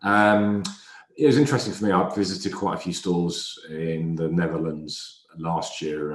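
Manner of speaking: normal talking voice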